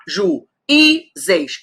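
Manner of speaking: "Juízes" in separated syllables, high-pitched, ju, í, zes. Shown as a demonstration. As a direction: break "Juízes" apart so í stands alone. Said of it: "Está sozinho na sílaba?".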